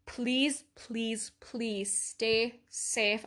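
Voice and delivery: ordinary delivery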